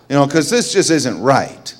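normal talking voice

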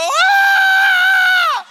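very high, sustained, loud scream; piercing reenacted cry; astonished scream. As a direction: let out a long overwhelmed shriek. Reenacts his own scream.

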